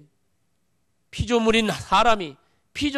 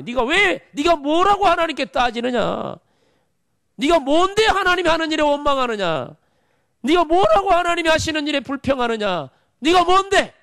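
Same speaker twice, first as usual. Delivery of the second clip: higher, louder, building rebuke; fervent preaching; 6 semitones higher, 3 dB louder than usual. Acted. Preacher confronting proud believers, rising to a louder rebuke. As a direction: press the question harder each time.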